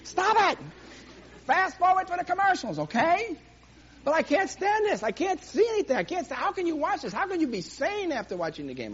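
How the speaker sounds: high, loud, exasperated griping; mock complaining spouse. Preacher voices someone's complaint about game.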